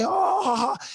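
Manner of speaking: high eerie imitated laugh; mock exorcist laugh. Imitating a relative's eerie laugh during prayer. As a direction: cackle like a possessed man in a film. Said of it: "se empezaba a reír como una película de exorcista".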